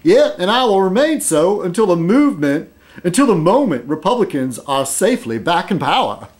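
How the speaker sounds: twang it like a Southern conservative; Southern accent. Shown as a demonstration. Redoing the penguin's line with a Southern accent. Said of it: "I should do this with a Southern accent".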